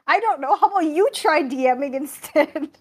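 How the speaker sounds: loud, high-pitched mock-indignant retort; exasperated comeback. Game master to players mocking the accent, exasperated. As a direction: throw it back at your critics, breaking into a laugh. Snapping back at teasing players.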